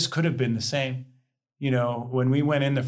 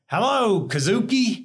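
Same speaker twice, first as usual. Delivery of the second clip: a light, raised, booming Texan hello; hearty Texan greeting; 7 semitones higher, 3 dB louder than usual. Retold or imitated. A Texas professor greeting the new student, lightly imitated big hello. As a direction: greet him like a jovial old Texan professor. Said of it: "good old Texan".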